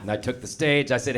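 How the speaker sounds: normal talking voice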